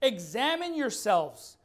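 slow, deliberate scripture reading. Shown as a demonstration. Preacher rereading the verse for the congregation, slowly. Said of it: "Let's read it slowly".

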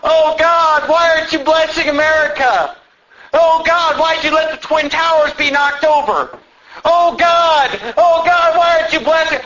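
high, loud whining plea; hammed-up crying complaint; mock whining. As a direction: mimic someone whining to God. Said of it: "somebody crying and whining".

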